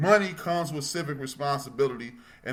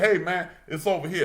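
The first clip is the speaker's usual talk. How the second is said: calling out; 8 semitones higher, 5 dB louder than usual. Retold or imitated. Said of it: "and I said".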